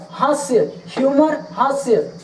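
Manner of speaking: normal talking voice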